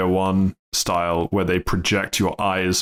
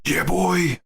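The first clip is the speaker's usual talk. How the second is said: hype exclamation; 10 semitones higher than usual. Real, not acted.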